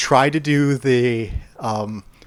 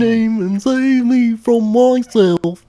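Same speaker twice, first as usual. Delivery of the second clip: slow, higher Goofy voice singing the line; Goofy impression; 8 semitones higher, 4 dB louder, slower than usual. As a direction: croon it as Goofy. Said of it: "that's a decent Goofy".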